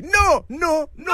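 high-pitched angry line calls; mock rage. Shown as a demonstration. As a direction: fire off repeated refusals in pretend fury. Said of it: "Y con, con rabia, así". (gritos agudos con rabia fingida)

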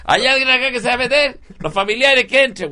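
high, loud shouted announcement; calling out loudly. Acted. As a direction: shout it to the whole beach. Lifeguard calling out to crowd.

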